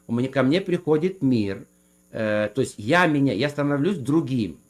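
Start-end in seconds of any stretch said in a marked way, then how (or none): none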